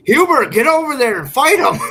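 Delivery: loud, high-pitched yell at the TV; retold yelling. Fan yelling at the goalie during Friday's game, loud shouting. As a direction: holler it like a fan at the screen. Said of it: "and I'm yelling".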